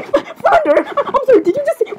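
very high, squeaky astonishment; giggly disbelief. Acted. Princess questioning Flounder about the clip, shocked and giggly. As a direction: ask it in a high squeal, breaking into laughter. Shocked reaction to the played line.

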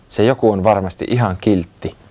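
ordinary delivery, nothing marked

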